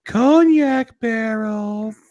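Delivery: drawn-out, rising announcer-like reveal; showy reveal. Pretend. Revealing today's cognac beer to his co-host, showy excitement. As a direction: stretch it high with mock grandeur. Gasped reveal of favorite spirit.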